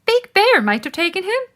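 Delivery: high, quick rabbit voice; rabbits' voice. A narrator's reading. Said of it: "said the rabbits".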